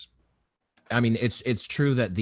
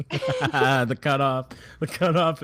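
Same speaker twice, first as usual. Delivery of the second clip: laughing; 5 semitones higher, 3 dB louder, slower than usual. Real, not acted. Laughing at a clip cutoff.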